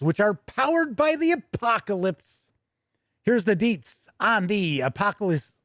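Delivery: upbeat, brisk product-news read; a bright announcer reading the release. Acted. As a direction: present it like a news anchor. Segue into next news item.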